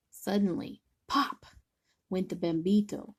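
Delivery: ordinary delivery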